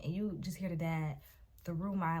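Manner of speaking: usual speaking voice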